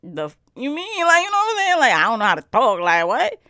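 loud, high incredulous outburst; mock-offended loud what; retold loud disbelief. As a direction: blurt it out, offended and baffled. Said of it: "I'm like".

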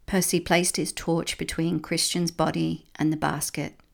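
ordinary delivery, nothing marked

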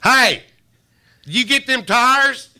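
loud high yelling at the phone; retold loud yelling at the phone; shouted question. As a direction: holler it across the room.

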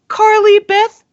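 put-on high, loud squeaky girl asking her own name; a mock timid girl voice, pitched high; girl's high questioning reply; imitating Carly Beth. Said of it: "she goes to see the shopkeeper".

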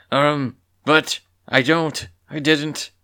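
loud, high, halting denial; slow, halting, rattled protest; flustered stammering. A narrator's reading. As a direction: stammer it out, caught out. Cornered Pollen stammers an excuse.

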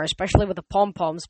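ordinary delivery, nothing marked

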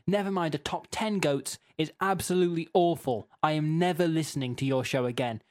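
ordinary talk, nothing marked

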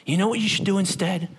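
normal talking voice

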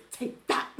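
whispered mock triumph; inner voice. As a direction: hiss it in a secret whisper, amused. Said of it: "her inner voice goes".

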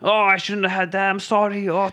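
high-pitched mock groaning; pained moaning. Imitating the cartoon dad regretting his meal, pained moan. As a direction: groan through it like a sick cartoon character.

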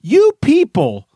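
high, slow build into a rant; rant opener. Real, not acted. Host starting a side rant on retirees. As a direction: launch the rant. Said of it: "Side rant".

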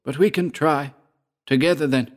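rally a companion in a high voice; Georg. A narrator's reading. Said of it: "said Georg".

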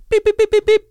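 loud, high-pitched repeated alarm beeps; imitated alarm beep. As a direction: beep like a shop security gate. Imitating the shop alarm going off.

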